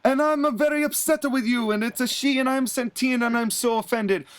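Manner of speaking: high, hammed-up offended Italian voice; upset Santina in mock Italian. [name] retelling Santina complaining to Arn, in a high mock-Italian accent. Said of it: "Santina gets upset".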